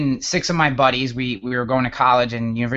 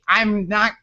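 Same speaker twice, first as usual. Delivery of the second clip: normal talking voice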